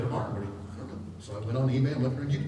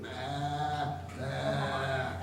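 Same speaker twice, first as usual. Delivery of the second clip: sheep bleat; 3 semitones lower, 9 dB quieter than usual. Retold or imitated. Imitating the baby sheep.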